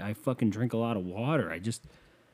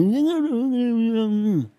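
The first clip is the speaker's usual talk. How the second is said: squeal; 13 semitones higher, 7 dB louder than usual. Real, not acted. Squeal after the story.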